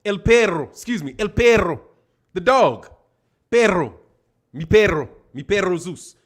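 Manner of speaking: mock Spanish accent, coaxing the dog; lightly put-on Spanish accent talking to the dog. Calling the dog in a Spanish accent, coaxing. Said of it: "when we move".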